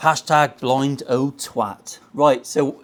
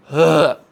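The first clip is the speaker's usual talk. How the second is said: disgusted groan; 7 dB louder than usual. Real, not acted. Disgust at the guitar.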